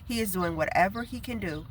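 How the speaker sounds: normal talking voice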